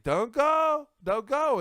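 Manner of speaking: high, loud pleading warning; urgent plea. Retold or imitated. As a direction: beg her not to go. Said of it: "my sister was like".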